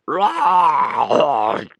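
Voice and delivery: loud, gurgling monster roar. A narrator's reading. Corpse rises and gurgles right into Gobber's face. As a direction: roar it wetly like a drowned corpse. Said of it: "gurgled the corpse".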